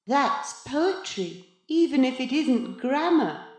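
high, drawling condescension; lofty cat in boy's body. A narrator's reading. The thing like Maurice answers his protest, loftily. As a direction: brush off his protest with airy superiority.

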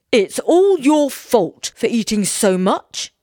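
quarrelling husband's accusation; accusing. A narrator's reading. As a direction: lay the blame with drawn-out force. Woodman blames his wife.

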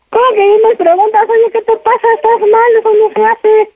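high-pitched, offended protest; real indignant rebuke, pitched high; indignant outburst. Real, not acted. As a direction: push your pitch up in outrage. Daughter indignantly rebukes in phone quarrel.

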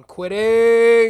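high, slow, belted ending; sudden shout. Pretend. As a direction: belt the last words out. Sudden dramatic flourish.

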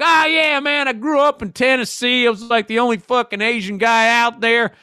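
high Southern cabbie impression, easing down; hardcore Southern accent. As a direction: imitate a chatty Tennessee accent, starting high. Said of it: "the most hardcore Southern accent".

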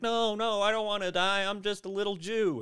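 high, whiny begging voice; pleading victim voice. Retold or imitated. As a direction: beg pitifully for your life.

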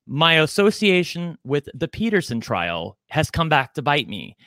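ordinary talk

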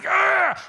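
loud, high "Argh!" of frustration; frustrated growl. Retold or imitated. Venting relationship tension to the congregation, loud frustrated groan. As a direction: let out a tense groan. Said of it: "It's like".

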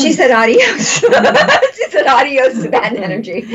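high, loud laughing retelling. Real, not acted. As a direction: laugh it out delightedly.

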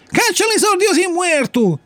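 high, insistent villain cry; taller assassin's threat. A narrator's reading. Taller assassin threatens the puppet with death, crying menacingly. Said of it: "disse l'assassino più ierto".

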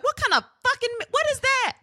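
very high-pitched mock bewilderment; acted baffled disbelief. Friend retelling reaction to bean meme, high-pitched bafflement. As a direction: squeal the question in disbelief.

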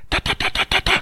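soft unvoiced rat-a-tat of rockets; imitated gunfire. Veteran mimics rocket fire in his story, soft whispered rat-a-tat. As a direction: tap out the gunfire under your breath.

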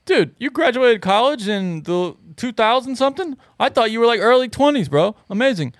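high-pitched dopey dude voice; dumb bro voice. Retold or imitated. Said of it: "give him the worst dumb accent when you did him".

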